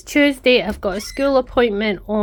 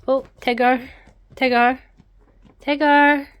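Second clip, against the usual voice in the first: calling the cat; 5 semitones higher, 4 dB louder than usual. Real, not acted.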